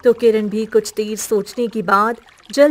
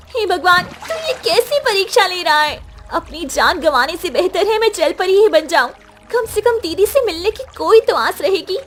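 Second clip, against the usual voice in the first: put-on high, loud, desperate girl; a girl praying in despair; 9 semitones higher, 3 dB louder than usual. (बेबस लड़की की आवाज़)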